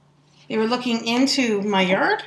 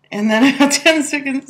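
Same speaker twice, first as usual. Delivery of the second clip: ordinary talk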